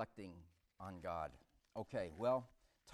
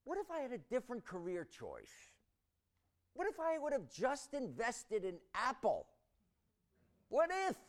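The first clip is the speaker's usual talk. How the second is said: rising anxious what-ifs; 8 semitones higher than usual. Acted. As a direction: pitch climbing higher with each what-if. Acted worry rising to a cry.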